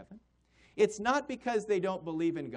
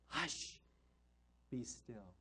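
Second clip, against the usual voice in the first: whispered and much softer, gentle but firm; hushed command; 8 semitones lower, 12 dB quieter, much slower than usual. Retold or imitated. Jesus speaking to the stormy sea, a soft whispered hush.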